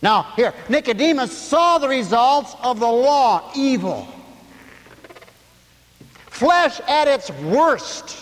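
high, loud, fervent preaching; full-force preaching. Acted. Preacher to his congregation, full-force preaching.

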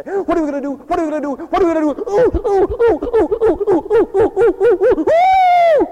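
high, loud mock panic, hooting at the end; panicked crowd. Preacher mimicking everybody else on rough waters, panicked. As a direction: flail in comic hysteria, rising into shrill hoots. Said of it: "everybody else is all like".

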